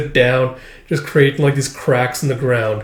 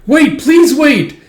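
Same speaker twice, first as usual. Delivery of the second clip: high, loud, drawn-out begging; desperate plea; 13 semitones higher, 7 dB louder, much slower than usual. Acted. Captive begging the emperor to spare someone, desperate cry. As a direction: beg him loudly to wait.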